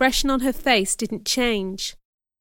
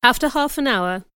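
plain reading voice

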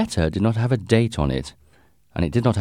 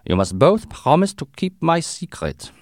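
put-on higher-pitched German-accented Count; strong German accent; 10 semitones higher than usual. A narrator's reading. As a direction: press them in a thick German accent. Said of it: "He had a strong German accent".

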